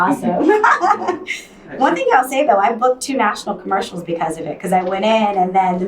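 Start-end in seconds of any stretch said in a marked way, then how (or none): 0.5-1.4 bright burst of laughter; high laugh. Real, not acted. Laughs about loving fans.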